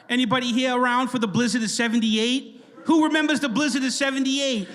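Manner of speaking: put-on high, broad Boston accent; teasing imitation of a Boston accent; mock Boston accent. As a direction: call out like a Boston mom, R's dropped. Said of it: "make fun of our mom's accent".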